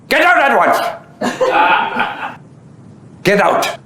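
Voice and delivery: high, laughing mock roar of get out; Adi's angry voice. Adi throws the two men out, high laughing mock roar. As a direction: bellow like a fed-up lion, half laughing. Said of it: "in his Adi K Rani voice".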